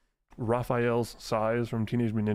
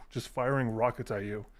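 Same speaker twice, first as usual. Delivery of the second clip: ordinary talk, nothing marked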